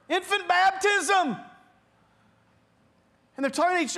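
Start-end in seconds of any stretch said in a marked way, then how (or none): 0.1-1.4 high, slow call from the water; kid calling out. Acted. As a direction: stretch the cry out like a kid drifting by. Third kid calling from river.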